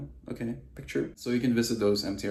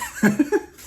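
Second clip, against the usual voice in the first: high laugh; 10 dB louder than usual. Real, not acted. Laugh alone.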